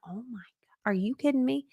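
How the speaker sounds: breathless whispered disbelief; stunned whisper. Retold or imitated. Coach tells viewers of her amazement, whispering. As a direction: whisper it like you can't believe your ears.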